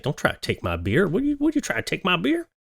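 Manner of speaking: high, fast whiny protest over his beer; hammed-up tipsy beer defender; mock drunk guy. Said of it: "He's like".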